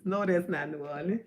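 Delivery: laughing while saying it; chuckling denial. Real, not acted. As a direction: giggle through the words. Laughing at caller.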